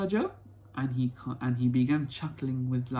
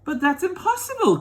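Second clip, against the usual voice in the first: put-on much higher, louder young boy's outcry; boy crying out; 8 dB louder than usual. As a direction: burst out like an amazed little boy. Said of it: "cried Charlie".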